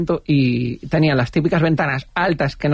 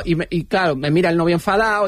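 ordinary delivery

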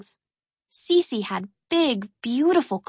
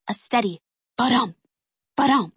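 heartbeat sound. A narrator's reading.

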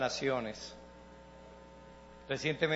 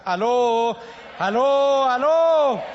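loud, rising higher call; 8 semitones higher, 11 dB louder, much slower than usual. Acted. Pastor loudly calls the congregation to attention. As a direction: call the room to wake up.

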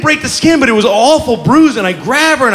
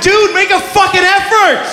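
mock outraged kid; 10 semitones higher than usual. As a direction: yell at dad to try harder. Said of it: "She's like".

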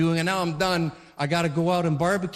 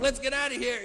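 put-on hurried kid; 8 semitones higher, 4 dB quieter than usual. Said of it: "one's so fast, does everything fa".

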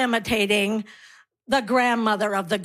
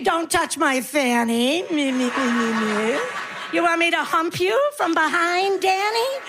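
pretend deaf granny; 5 semitones higher than usual. Comic answering the heckle, pretending to be a deaf granny. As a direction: play a deaf granny mishearing everything. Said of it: "I pretend I'm deaf".

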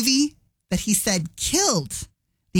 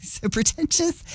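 laughing scoff; 9 semitones higher than usual. Real, not acted. Host scoffs at Ebert quote.

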